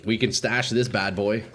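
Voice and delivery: ordinary talk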